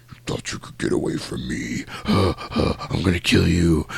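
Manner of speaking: whispery, breathy stalker voice; creepy voiceless taunt with gasps; menacing killer. Retold or imitated. Voices Freddy stalking up behind her.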